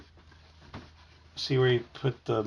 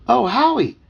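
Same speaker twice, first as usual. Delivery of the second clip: high, loud delighted cry; calling out; 12 semitones higher, 9 dB louder than usual. A narrator's reading. Boy greets his beloved dog, high loud call. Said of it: "Toby called out".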